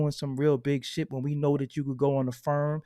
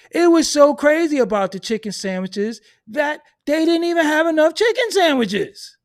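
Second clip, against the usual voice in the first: ranting shout; 11 semitones higher, 9 dB louder than usual. Real, not acted. Ranting about chicken sandwich frenzy.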